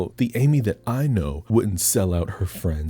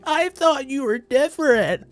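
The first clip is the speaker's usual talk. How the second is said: mock scream. Recapper voicing Lucy screaming.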